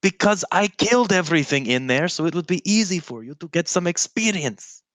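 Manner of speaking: high-pitched, exasperated comeback; exasperated justification. Acted. In-character quarrel over the cave.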